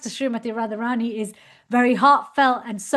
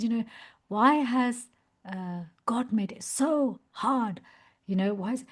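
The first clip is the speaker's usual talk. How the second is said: soft, hesitant first asking of the question; timid first question; 6 dB quieter than usual. Retold or imitated. Young devotee asking his teacher timidly, soft hesitant question. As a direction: ask it quietly, unsure of yourself. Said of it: "approached Srila Prabhupada to ask him".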